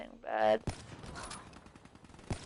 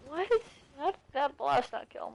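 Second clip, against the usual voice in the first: sudden high disbelieving yelp; real surprise; 5 semitones higher than usual. Real, not acted. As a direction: blurt it out, shocked the blast failed. Reacting to gameplay live.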